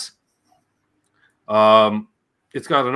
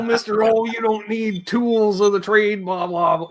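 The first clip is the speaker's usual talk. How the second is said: higher, mocking parody of father; 8 semitones higher than usual. Son mocking his father's no-pedals lecture, higher and parodying. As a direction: mimic dad's lecture, scornful. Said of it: "You know".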